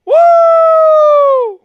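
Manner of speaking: high piercing celebratory woo; shrieked woo. Real, not acted. Fan screaming woo after the touchdown. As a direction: let out a wild high woo.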